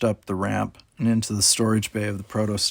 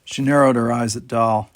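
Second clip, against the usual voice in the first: usual speaking voice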